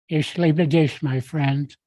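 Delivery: usual speaking voice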